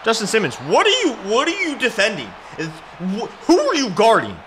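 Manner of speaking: high, exasperated yelling at a player; lashing out. Real, not acted. Gamer scolding his defender, angry lashing out. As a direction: berate him in rising disbelief. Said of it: "Sorry, I lashed out".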